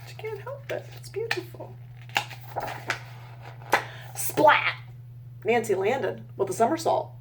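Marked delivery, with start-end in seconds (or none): 0.1-1.8 soft, breathy admiring whisper; hushed aside. Real, not acted. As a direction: murmur it in quiet awe. Adult admiring illustration aside.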